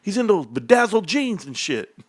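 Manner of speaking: high, insistent mock-girl voice; imitated girlfriends. Wife's friends pointing out her man, high imitated insistence. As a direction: point him out in high, hammed-up alarm. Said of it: "They're like".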